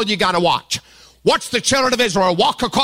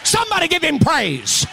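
higher, shouted preaching of victory; rally the church to a shout; preaching at full force; 5 semitones higher than usual. Acted. Pastor rousing the congregation toward praise, shouting.

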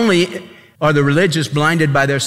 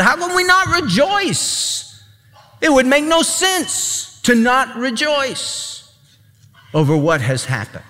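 amazed exclamation; 9 semitones higher than usual. Real, not acted. Preacher's exclamation.

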